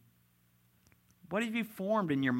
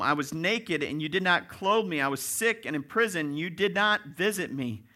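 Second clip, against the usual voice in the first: raised, emphatic litany of charges; louder, insistent pulpit recitation; forceful scripture preaching; 3 semitones higher, 5 dB louder than usual. Acted. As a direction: list each charge with force.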